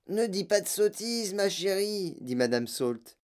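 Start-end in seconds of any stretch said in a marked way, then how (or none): 0.1-2.2 high-pitched motherly voice; placating mother. A narrator's reading. Mother to demanding daughter, placating. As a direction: coax in a raised pitch.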